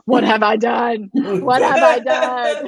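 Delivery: loud, drawn-out cry of regret; hammed-up parental despair; mock despairing shout. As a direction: wail it slowly in comic exasperation. Acts out exasperated parent's despair.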